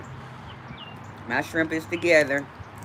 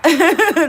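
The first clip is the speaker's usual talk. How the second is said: burst of laughter; 9 semitones higher, 6 dB louder than usual. Real, not acted. Real burst of laughter on stream.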